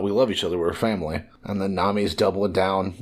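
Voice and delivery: ordinary talk, nothing marked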